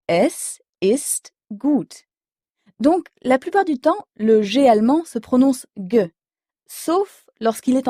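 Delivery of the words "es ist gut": "Es ist gut" very slowly with an ou in gut; slow German model. Shown as a demonstration. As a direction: model "Es ist gut" one last time, slow and clear.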